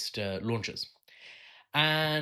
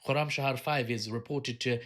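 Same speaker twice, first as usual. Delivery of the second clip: ordinary talk, nothing marked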